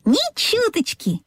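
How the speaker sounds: high, slow, cheery denial; reassuring little engine. A narrator's reading.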